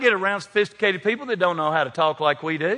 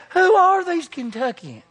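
high, slow incredulous question; mock amazement; 12 semitones higher, 4 dB louder, slower than usual. Washington crowd reacting to Kentuckians, astonished high question. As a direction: draw it out in put-on disbelief. Acting out shocked Washington onlookers.

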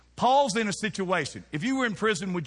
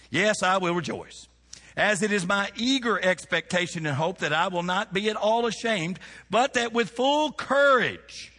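preaching at full force; 4 semitones higher, 5 dB louder than usual. Acted. Preacher reads Paul passage.

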